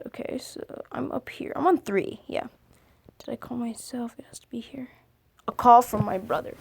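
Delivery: soft whispered searching; muttering to herself. Real, not acted. Searching the page, whispered aside. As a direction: whisper under the breath while scrolling.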